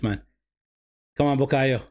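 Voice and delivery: ordinary talk, nothing marked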